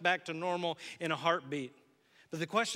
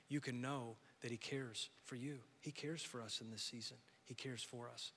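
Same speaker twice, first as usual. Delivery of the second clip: tender reassurance; 6 semitones lower, 9 dB quieter than usual. Acted. Pastor voicing Jesus' care for the fearful, soft and fading. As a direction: comfort them gently, fading out.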